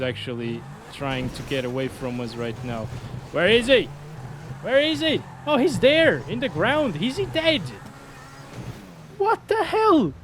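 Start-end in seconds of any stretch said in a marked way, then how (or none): 3.3-10.1 high, rising alarm calls; alarmed search. Real, not acted. Gamer searching frantically for his dog Jack, alarmed and high. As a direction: panic looking for the dog.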